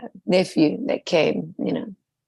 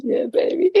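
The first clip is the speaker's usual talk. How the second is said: delighted; 9 semitones higher than usual. Real, not acted. Delighted reaction to phrase.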